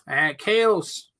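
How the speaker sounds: ordinary talk, nothing marked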